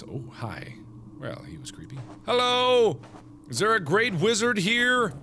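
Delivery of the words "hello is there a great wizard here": higher, louder call into the cave. Acted. Fran calling for the great wizard, in a high loud call.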